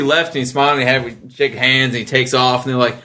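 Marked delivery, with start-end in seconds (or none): none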